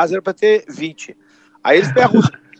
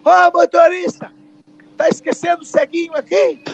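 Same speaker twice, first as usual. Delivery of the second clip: crowd yelling at driver; 11 semitones higher than usual. Retold or imitated. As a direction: shout up at the driver for everyone at the stop. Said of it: "todo mundo ao mesmo tempo gritou".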